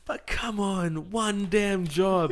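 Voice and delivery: high, slow, drawn-out exasperation; exasperated plea. Real, not acted. Complaining to friend about the cashier, slow exasperated. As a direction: stretch it out in comic despair. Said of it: "complaining about the cashier".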